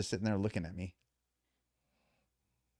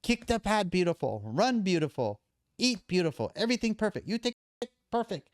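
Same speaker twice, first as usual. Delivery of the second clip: imitating Thai trainer; 12 semitones higher than usual. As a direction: play the stern Thai coach scolding, high and halting. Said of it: "He goes".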